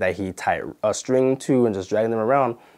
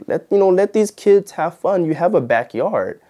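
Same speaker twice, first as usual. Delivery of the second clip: higher-pitched exasperated plea; retold protest, pitched up and louder at the start; exasperated retort; 8 semitones higher, 3 dB louder than usual. Worker to parents putting kids to work, exasperated.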